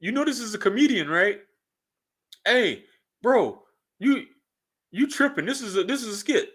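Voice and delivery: high-pitched mocking imitation of a know-it-all commenter. Regular people pointing out it's a skit, high mocking voice. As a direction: put on a high voice to mimic people. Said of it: "it's regular American people that's just like".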